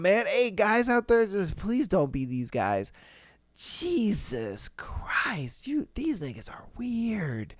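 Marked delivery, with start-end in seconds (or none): 3.6-7.5 hushed, drawn-out disbelief; appalled whisper. Real, not acted. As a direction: whisper it slowly, appalled at these guys. Appalled reaction to the creepy story.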